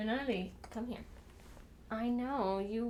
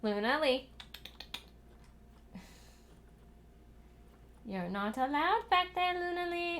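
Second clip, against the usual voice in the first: sing-song scolding; 6 semitones higher than usual. Real, not acted. Telling Luna off, high and sing-song. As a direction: scold the cat in a lilting voice.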